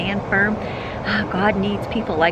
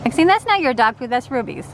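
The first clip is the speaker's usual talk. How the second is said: dog scolding dog; 6 semitones higher, 4 dB louder than usual. Pretend. Voicing Ruby scolding Maxine.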